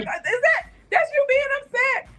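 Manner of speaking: high-pitched incredulous teasing; teasing disbelief. Retold or imitated. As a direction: tease him in a high, amused voice. Teasing the upset nephew, retold.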